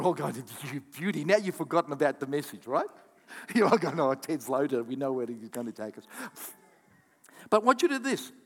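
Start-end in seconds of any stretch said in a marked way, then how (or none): none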